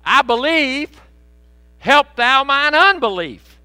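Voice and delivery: loud, high, slow plea; father begging Jesus to help; desperate father. Retold or imitated. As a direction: cry out as a pleading father. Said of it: "He said".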